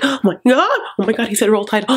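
imitating a gushing, excited contestant. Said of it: "she gets so excited".

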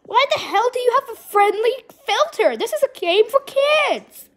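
high, loud ranting; indignant yelling. Real, not acted. Player to Roblox about the filter, indignant yelling. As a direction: demand an answer from the game makers.

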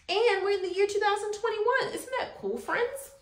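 bright, singsong circle-time teacher voice; earnest upbeat school lecture. Acted. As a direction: greet the class like a cheerful kindergarten teacher. Circle time calendar greeting.

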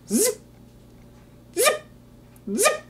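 high quick zip sounds; zipping arrows. Acted. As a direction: flick out each zip. Arrows flying.

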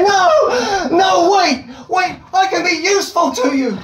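high, pleading cry; panicked plea. Pretend. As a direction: beg before the hatch shuts. Panicked plea as hatch shuts.

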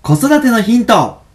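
radio program opener; title announcement. Acted.

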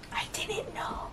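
high unvoiced whisper. Real, not acted. Confessing to viewers in a stunned whisper. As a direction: breathe it out in disbelief. Crying over plot twist.

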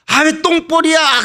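high, agitated armchair-fan cries; mock-anguished match-watching outburst; frustrated fan. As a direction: play a fretting soccer fan yelling at the TV. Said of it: "안타까워가지고".

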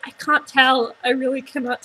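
laughing through words; amused grin speech; smiling while talking. Real, not acted. Seen 2: Bite at -3s; no chewing seen during the words, broad smile instead.

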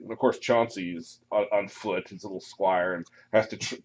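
normal talking voice

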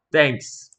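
usual speaking voice

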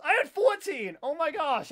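high, loud exclamation of disbelief; excited outburst. Real, not acted. Streamer reacting to their kill count, excited outburst. Reacts to own score in game.

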